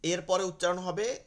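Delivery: normal talking voice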